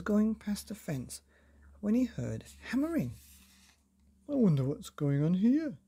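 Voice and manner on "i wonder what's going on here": a touch of nosy curiosity; curious Mister Nosey. A narrator's reading. Mister Nosey sneaking by the fence, lightly curious. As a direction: wonder aloud, nosy. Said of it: "he thought to himself".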